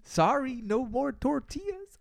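high, loud, slow character voice; movie character voice. Retold or imitated. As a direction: squeak it out in a silly high voice. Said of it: "He's like".

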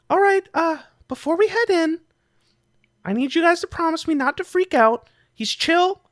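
high-pitched little creature voice; Griddle. Acted. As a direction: speak up high as a cave guide. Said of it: "Griddle stops before they".

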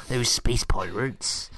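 slow West Country pirate voice; West Country pirate accent. Pretend. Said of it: "West Country accent coming through".

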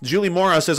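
ordinary delivery

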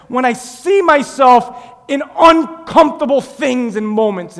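slow, shouted, high declaration; full-force preaching. Acted. Preacher voicing Paul's claim to glory in suffering, shouted preaching. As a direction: proclaim it with every word stretched and loud.

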